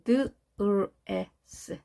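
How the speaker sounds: 드을 에스 slowly, sound by sound; 드을 에스 in separate slow phonetic pieces. Shown as a demonstration. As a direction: spell out 드을 에스 as d, r, e, s with gaps. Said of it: "알 발음".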